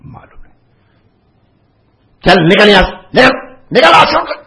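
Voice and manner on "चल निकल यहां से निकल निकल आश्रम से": loud, high furious shout; furious expulsion. Retold or imitated. Guru expelling the disciple from the ashram, furious shout. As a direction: throw him out with a yell. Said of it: "ऐसे नाराज हुए".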